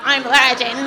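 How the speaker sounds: blurting it out loud; loud declaration. Retold or imitated. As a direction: announce it proudly and loud. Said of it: "I was like".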